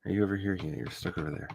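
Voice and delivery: normal talking voice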